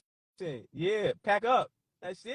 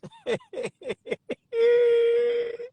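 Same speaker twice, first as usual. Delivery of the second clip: high stuttering laugh into a loud squeal; helpless laughing; 11 semitones higher, 8 dB louder than usual. Real, not acted. Streamer cracking up at a comment, loud high cackle. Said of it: "I'm laughing".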